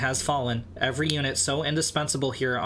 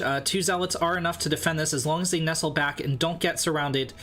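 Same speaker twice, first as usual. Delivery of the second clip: ordinary talk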